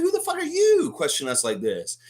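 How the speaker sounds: high, disbelieving retort; mock incredulous player. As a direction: act like you can't believe the question. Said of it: "They were, like, incredulous".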